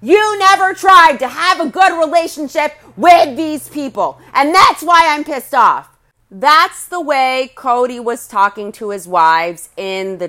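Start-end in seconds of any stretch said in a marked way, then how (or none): 0.0-5.9 imitating an angry shout, higher and louder; mock yelling at his wife, hammed-up; raised voice quoting a furious husband; retold angry yell. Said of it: "He's looking at Janelle screaming".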